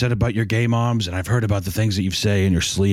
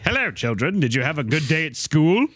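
a hint of teacher voice; 7 semitones higher than usual. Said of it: "Mister Ratburn's like".

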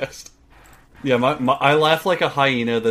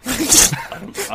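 ordinary delivery

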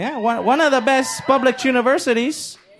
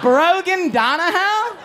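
high, slow, disbelieving; higher and slower in disbelief; incredulous; 9 semitones higher, slower than usual. Real, not acted. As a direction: say the name like you can't believe it. Mocking the name, slowed.

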